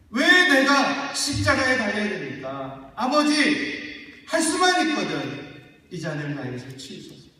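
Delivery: loud, high desperate prayer; Jesus begging loudly in Gethsemane; anguished pleading. Acted. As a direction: cry out to the Father in agony.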